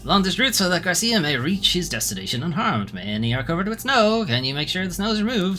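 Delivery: a hint of a grand old lamplighter ghost; lightly acted spectral guide; lightly voiced ghost. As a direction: read the ghost lines with mild theatrical weight. Reads the ghost's dialogue aloud.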